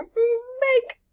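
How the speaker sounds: very high, long-held sung-out cry; Bake Off catchphrase. Retold or imitated. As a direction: call it out high like a baking show host. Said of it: "I have to go".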